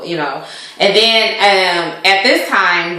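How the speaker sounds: normal talking voice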